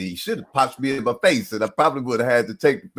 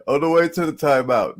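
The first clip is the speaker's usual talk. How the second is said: ordinary delivery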